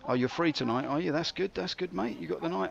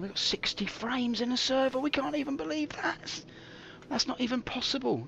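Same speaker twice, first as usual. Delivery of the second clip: much higher, thrilled; excited disbelief; 10 semitones higher than usual. Acted. Streamer amazed at server frames, excited and high.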